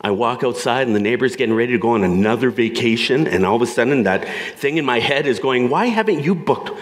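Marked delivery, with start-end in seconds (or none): 5.7-6.7 high-pitched rant, climbing to a peak on the question; worked up, pitch rising higher and higher; fired-up inner voice. Acted. Nagging inner voice frets over the neighbor's vacation, fired up, high. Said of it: "it just gets fired up".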